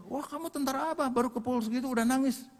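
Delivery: high-pitched retold taunt; mock scolding. Comrade teases injured soldier for crying, mocking. Acts out comrade teasing his tears.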